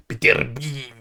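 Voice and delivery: "Beterbiev" with a hammy Russian accent; a failed stab at a Russian accent on "Beterbiev". Shown as a demonstration. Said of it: "my failed Russian accent".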